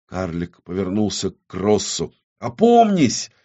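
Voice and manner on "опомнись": high-pitched urgent exclamation; urgent cry. Acted. Dwarf turns to Кросс, crying out urgently. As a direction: cry out to bring him to his senses.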